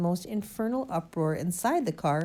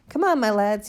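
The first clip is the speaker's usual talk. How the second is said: loud, higher-pitched rallying call; hearty cry; 4 semitones higher, 8 dB louder than usual. A narrator's reading. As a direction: call out to rouse your lads. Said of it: "he cried".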